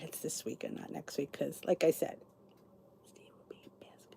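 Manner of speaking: half-whispered gossip aside; conspiratorial whisper. Real, not acted. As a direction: lean in and whisper it. Gossiping aside about husband Steve.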